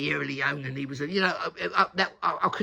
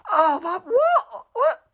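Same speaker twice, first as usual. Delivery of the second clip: laughing panic; 13 semitones higher, 8 dB louder, much slower than usual. Retold or imitated. Panicked thought while fleeing, laughing.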